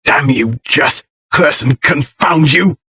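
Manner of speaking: ordinary delivery, nothing marked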